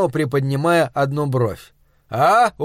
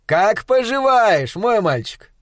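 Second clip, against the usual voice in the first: hearty greeting; 6 semitones higher, slower than usual. Acted. Fond greeting.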